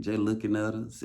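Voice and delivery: ordinary delivery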